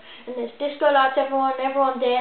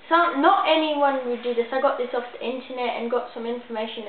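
ordinary talk, nothing marked

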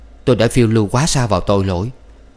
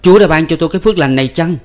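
higher, fast mocking jab; sarcastic sneer; 8 semitones higher, 5 dB louder, faster than usual. A narrator's reading. Husband mocking his wife's faith, sarcastic sneer. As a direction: sneer it quickly.

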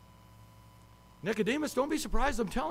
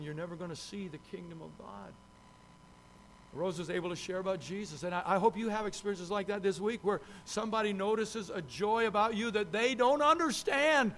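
normal talking voice

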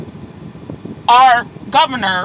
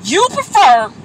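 normal talking voice

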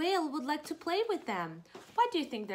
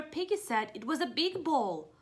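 ordinary delivery

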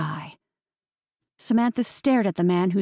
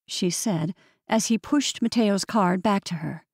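ordinary delivery

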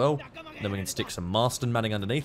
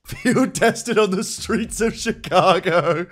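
laughing; 7 semitones higher, 5 dB louder, slower than usual. Real, not acted. Joke about the Owen gun.

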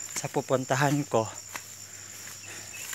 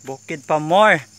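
exasperated; 2 semitones higher, 8 dB louder than usual. Real, not acted. Mock complaining about muddy climb.

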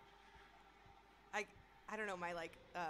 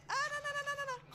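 high, loud string of panicked cries; frantic high-pitched panic; panicked shriek; 10 dB louder, much faster than usual. Real, not acted. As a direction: squeal it in rising alarm. Panicking at creature in game.

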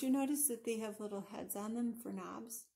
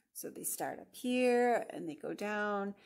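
ordinary delivery

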